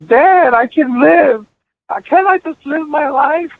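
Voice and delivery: high, drawn-out mock wail; imitated crying. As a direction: imitate a teen daughter wailing at her dad. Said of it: "She started crying".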